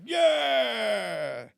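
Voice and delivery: loud, high crowd cheer. Retold or imitated. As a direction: whoop like a whole room cheering.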